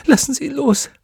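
very high, anguished plea; quick high cry for mercy; desperate pleading. Acted. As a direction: beg through torment. Tortured witch pleads.

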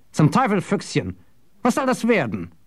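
high-pitched, exasperated cursing; indignant outburst. Acted. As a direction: demand an explanation, pitched well up. Jasper curses after being tripped.

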